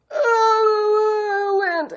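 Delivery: high crying wail, loud and broken; bawling. Retold or imitated. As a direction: wail it out through tears, retelling the sob. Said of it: "I freaking bawl".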